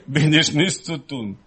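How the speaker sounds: usual speaking voice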